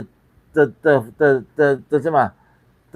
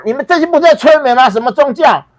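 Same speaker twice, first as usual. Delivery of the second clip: shouting higher and louder, still heated; angry challenge; 10 semitones higher, 6 dB louder than usual. Retold or imitated. Retelling confronting the religious gathering, angry shout. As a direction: hurl the accusation at the whole crowd. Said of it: "我呛说".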